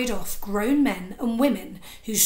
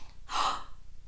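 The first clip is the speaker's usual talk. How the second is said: shocked gasp; 8 dB quieter than usual. Acted. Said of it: "gasped Bert".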